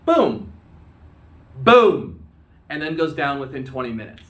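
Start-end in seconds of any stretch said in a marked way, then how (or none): 0.1-2.2 loud mock explosion boom, louder on the second; explosion noise. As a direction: boom it out like a torpedo blast. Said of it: "the boat goes s".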